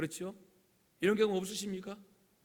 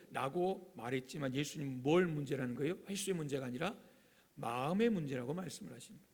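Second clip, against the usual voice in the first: usual speaking voice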